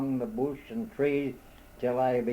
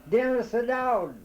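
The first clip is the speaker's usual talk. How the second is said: high, loud called name; calling out a name; 10 semitones higher, 4 dB louder than usual. A narrator's reading. Lost man shouting the cousin's name up to the owl.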